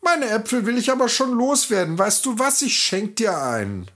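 fake-friendly farmer's wife; disguised peasant woman. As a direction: play along sweetly.

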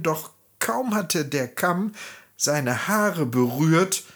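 usual speaking voice

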